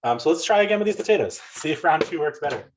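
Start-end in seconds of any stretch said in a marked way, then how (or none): none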